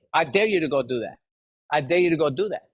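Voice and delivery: ordinary talk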